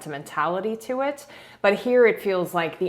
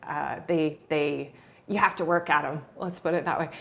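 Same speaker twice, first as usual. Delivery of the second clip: ordinary delivery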